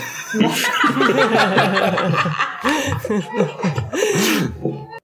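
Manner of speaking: long, high laughing fit; high-pitched, drawn-out laugh; helpless laughter. Real, not acted. Cracks up after the Jonathan joke.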